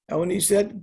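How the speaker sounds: ordinary talk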